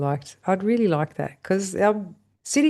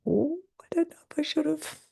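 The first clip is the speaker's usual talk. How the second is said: doubtful inner voice; 12 semitones higher, 4 dB quieter than usual. Retold or imitated. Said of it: "you think".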